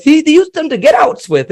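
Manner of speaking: incredulous. Real, not acted. Mocking Yankees' strategy.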